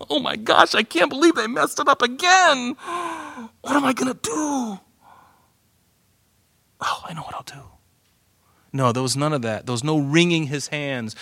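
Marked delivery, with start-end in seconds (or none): 0.1-4.8 high-pitched, hammed-up panic; mock freaking out. Preacher mocking the idea of God caught by surprise, freaking out. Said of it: "freaking out". 6.8-7.6 hushed, breathy stage whisper; mock whispered idea. Preacher playing God hatching a backup plan, whispered.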